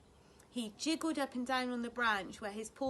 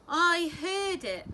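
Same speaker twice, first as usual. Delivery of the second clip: weary dry reply; 7 semitones higher, 9 dB louder, slower than usual. A narrator's reading. Tired mother dry reply.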